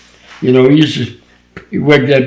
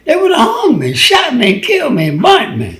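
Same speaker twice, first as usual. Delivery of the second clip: higher pitched rising outcry, shout on killed; exclaimed outburst; 12 semitones higher than usual. Real, not acted. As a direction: cry out the list of dangers in disbelief. Exclaiming how they'd have killed him.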